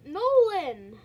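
loud call across the room. Real, not acted. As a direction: shout his name to get attention. Calling teammate who missed match.

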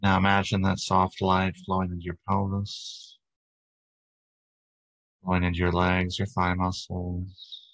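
calm, steady body-scan narration, little pitch movement; guided meditation. Real, not acted. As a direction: guide listeners gently into relaxation, unhurried. Leads a guided breathing meditation.

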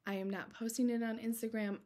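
usual speaking voice

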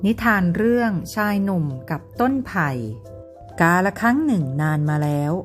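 ordinary delivery, nothing marked

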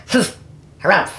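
loud grumpy harumph. A narrator's reading. Uncle Phil replying to Phyllis, grumpy harumphing. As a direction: huff out two dismissive harumphs. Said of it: "replied Uncle Phil".

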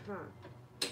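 normal talking voice

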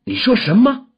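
high, fierce demand; sharp shouted demand. A narrator's reading. Woman grips the old man's wrist, shouting a fierce demand. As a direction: seize him with the question, pitched high. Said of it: "喝道".

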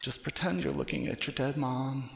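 ordinary delivery

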